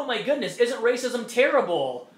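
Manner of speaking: sarcastic "isn't racism terrible"; mock hand-wringing. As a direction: parody pearl-clutching outrage. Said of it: "and you go".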